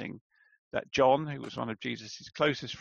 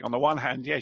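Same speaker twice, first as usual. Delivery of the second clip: usual speaking voice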